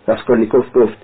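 usual speaking voice

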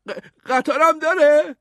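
high, loud, stammered amazement; excited. A narrator's reading. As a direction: burst out in a thrilled kid voice. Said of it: "ادموند هیجان زده شد و گفت".

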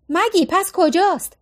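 calling out. A narrator's reading. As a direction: call out her name from above. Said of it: "فیونا داد زد".

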